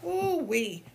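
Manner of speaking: ordinary talk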